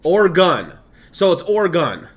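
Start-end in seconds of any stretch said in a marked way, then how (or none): none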